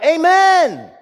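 high, loud church outburst; crying out. Retold or imitated. Southern boy cries out during the sermon, loud outburst. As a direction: holler it out in sudden fervour. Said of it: "cried out".

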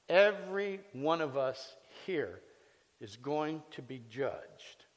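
slow, weighty stress on every one; slow emphatic warning. Acted. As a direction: lean on every word, solemn. Preacher stressing judgment.

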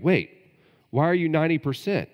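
a pastor catching a strange answer; surprised question. Retold or imitated. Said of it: "I'm like".

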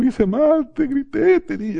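very high mock-crying daughter voice; hammed-up weepy confession; imitated tearful apology. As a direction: squeak out a melodramatic apology. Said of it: "me pide perdón y lloró y me dijo".

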